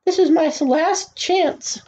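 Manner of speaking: put-on high, urgent boy's voice; anxious self-talk. A narrator's reading. Boy facing his last chance, high and anxious. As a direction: raise the pitch in mounting worry. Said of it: "he said to himself".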